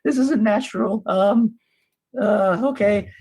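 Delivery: mildly nervous, high-pitched character voice; lightly acted unease. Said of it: "nervously holding it".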